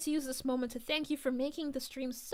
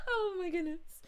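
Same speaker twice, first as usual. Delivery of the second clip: real laughter that keeps going, higher than usual; helpless laughter; 4 semitones higher than usual. Real, not acted. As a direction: laugh until it nearly turns to tears. Bursts out laughing at the fairy line.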